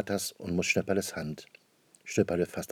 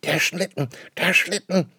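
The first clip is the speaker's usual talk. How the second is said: high, louder eager shout; excited child call; 9 semitones higher, 4 dB louder than usual. Acted. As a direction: call out in delight at the bells. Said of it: "ruft Schnüpperle". (Kind ruft aufgeregt, als es klingelt)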